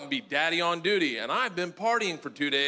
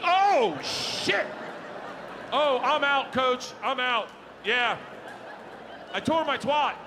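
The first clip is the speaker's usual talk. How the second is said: mock player calling out; 9 semitones higher than usual. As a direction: call to the coach in a hammed-up high voice. Acts hurt player calling to coach.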